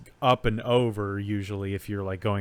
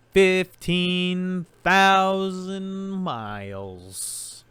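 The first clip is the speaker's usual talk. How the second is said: high, slow, drawn-out game show call; game show answer; 8 semitones higher, 3 dB louder, much slower than usual. Acted. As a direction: stretch it out like a big reveal.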